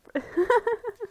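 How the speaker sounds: a high, bursting laugh; real laugh. Real, not acted. Cohost laughing at the nuke twist, high laugh. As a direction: cracks up at the absurd plot.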